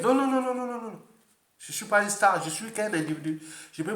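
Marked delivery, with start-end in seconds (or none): 0.0-1.0 high, rapid string of no's; emphatic refusal. Real, not acted. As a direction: cut the shouting off with insistent refusals. Rejette ces cris de fans. (refus catégorique, voix haute)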